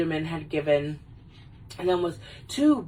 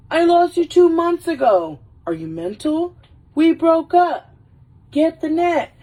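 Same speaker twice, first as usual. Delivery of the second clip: high, loud mock outburst; hammed-up breakup exasperation; exasperated retort; 10 semitones higher, 6 dB louder than usual. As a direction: fire back loudly, pitched right up. Wayne's exasperated retort, acted quarrel.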